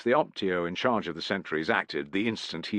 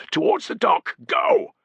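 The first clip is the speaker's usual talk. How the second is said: urgent shout; 14 semitones higher, 5 dB louder than usual. A narrator's reading. Urging comrade to flee.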